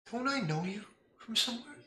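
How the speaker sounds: put-on high, soft little girl asking; a small child's curious question; child form. GM voicing Lila to the player, high childlike voice. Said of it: "in child form".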